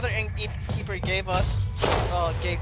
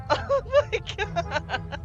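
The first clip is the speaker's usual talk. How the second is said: high, slow words through laughter; laughing; 9 semitones higher, much slower than usual. Real, not acted. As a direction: gasp it out while cracking up. Overcome with laughter at the game.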